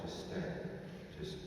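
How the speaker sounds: very soft, hushed soothing; calming reassurance. Acted. Jesus steadies someone ready to let go, soft and calm. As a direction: settle someone down in near-hushed tones.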